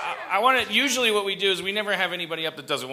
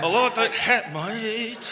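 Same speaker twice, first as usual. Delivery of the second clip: jaw-clenched mock Aussie, concentrating on vowels; clenched Australian; 5 semitones higher, much slower than usual. Shown as a demonstration. Host tries again, tense jaw-clenched Aussie accent. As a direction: force the Aussie vowels through a tight jaw. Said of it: "your jaw is clenched".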